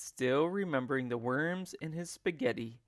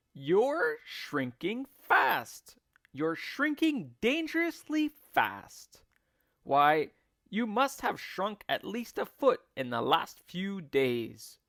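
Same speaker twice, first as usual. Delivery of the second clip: slow, louder, higher gloating; Mister Twit taunting; 6 semitones higher, 5 dB louder than usual. A narrator's reading. Husband frightening his wife, slow and gloating. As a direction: draw out the threat, relishing it. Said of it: "said Mister Twit".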